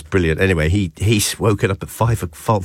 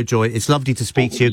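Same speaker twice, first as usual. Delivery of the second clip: normal talking voice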